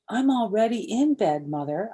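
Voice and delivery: loud call to the next room. Acted. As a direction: call it back through the door. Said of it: "I called back".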